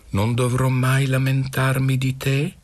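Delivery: high-pitched, doubting question; sceptical question. A narrator's reading. Mistress questions the boy's promise.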